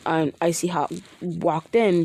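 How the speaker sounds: ordinary delivery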